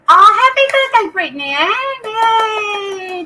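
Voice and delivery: happy birthday burst out high and loud; excited cheer. Real, not acted. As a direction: squeal the birthday wish with delight. Viewer's twenty-first birthday in chat.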